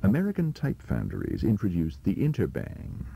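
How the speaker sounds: ordinary talk, nothing marked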